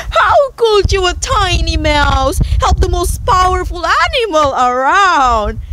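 mock-incredulous lion coming off a laugh; amused lion, chuckling. A narrator's reading. Lion amused by the tiny mouse's offer, acted chuckle. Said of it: "he chuckled loudly".

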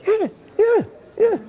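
tiny, very high-pitched yeahs; imitating frantic behind-the-phone assent; eager urging. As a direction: squeak out eager agreement. Acting his silent signalling to agent.

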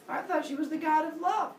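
high-pitched mock puzzled protest; naive objection. Second naive objection, then answered.

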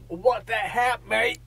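high, slow hammed-up Australian accent; mock Australian accent. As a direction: ham up a silly Aussie voice. Joking fake Australian accent.

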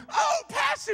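very high, excited mock squeal; gushing youth. Sweaty youth running up for a hug, mock gushing. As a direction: imitate an overeager kid greeting the pastor. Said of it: "and then they come".

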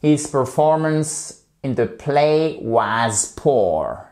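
"His performance in the play was poor" said slowly, word by word; slow model sentence. Shown as a demonstration. Teacher slowly modelling an example sentence for students. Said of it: "une phrase un peu détournée".

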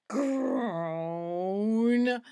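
long low ghost groan; ghostly groan. Acted. Hidden ghost groans in the dark, long low groan. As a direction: moan it out slowly.

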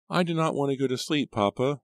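put-on very high little-girl voice; high, childish protest; young daughter. A narrator's reading. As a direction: plead like a child at bedtime. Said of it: "Rachel said".

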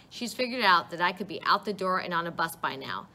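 plain reading voice

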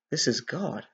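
soft, understated acted awe; hushed realization. Thief realizing who hangs beside him, hushed. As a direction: drop to a quiet, awed aside.

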